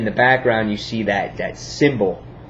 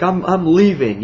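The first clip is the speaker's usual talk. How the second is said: high, louder imitation of panic; mock freaking out; 8 semitones higher, 5 dB louder than usual. President fleeing the cowboy, mock freak-out. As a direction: stammer it high as a retold panic. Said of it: "the president starts freaking out".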